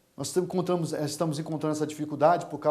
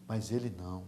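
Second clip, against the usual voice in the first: low, soft and understated; hushed; 7 semitones lower, 9 dB quieter than usual. Real, not acted. Preacher quietly setting Daniel apart, hushed. Quiet first take of repeated phrase.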